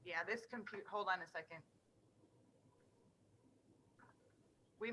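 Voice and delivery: very faint voice; faint, far from the mic; barely audible. Real, not acted. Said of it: "your voice is very faint".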